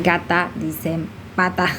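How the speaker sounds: ordinary delivery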